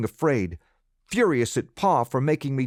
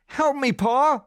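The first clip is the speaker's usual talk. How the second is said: high, loud, slow plea for help; desperate cry; 14 semitones higher, 4 dB louder, slower than usual. Acted. Boy crying out to Pa for help, high and panicked. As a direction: scream for your father.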